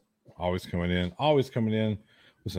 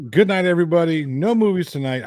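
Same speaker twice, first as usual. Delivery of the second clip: loud, raised-pitch fake farewell; mock sign-off; 6 semitones higher, 4 dB louder than usual. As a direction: abruptly declare the show finished as a joke. Joke early sign-off, then just kidding.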